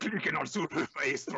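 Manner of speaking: high, loud cartoon command; hammed-up bilingual kids' host; mock Spanish Dora. Dora repeating the command in Spanish, cartoon voice.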